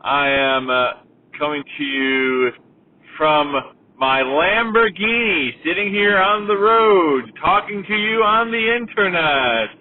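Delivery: loud, drawn-out greeting with swooping pitch; hammed-up show intro. Host boasting to viewers from a pretend Lamborghini, hammed-up intro. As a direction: stretch the words slowly like a grand host.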